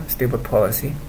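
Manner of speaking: usual speaking voice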